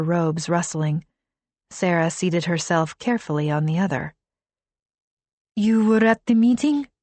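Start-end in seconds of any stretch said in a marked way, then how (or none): 5.5-6.9 put-on higher accented translation; Arabic accent. A narrator's reading. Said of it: "Kahina asked".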